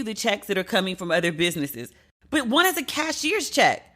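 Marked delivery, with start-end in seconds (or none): 2.3-3.8 high-pitched, outraged disbelief; livid rant. Real, not acted. Ranting to listeners about the bank holding her check, high and outraged. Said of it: "Can you hear that I'm livid?".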